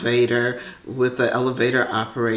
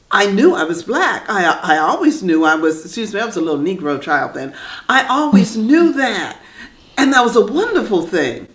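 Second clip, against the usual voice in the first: emphatic insistence; 6 semitones higher, 5 dB louder than usual. Real, not acted.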